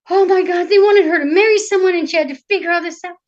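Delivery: tag overwhelmed. A narrator's reading. Narrator voices the princess's overwhelmed panic.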